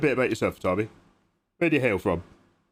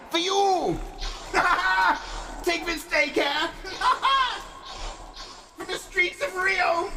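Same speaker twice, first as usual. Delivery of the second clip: high silly voice; 13 semitones higher, slower than usual. Acted.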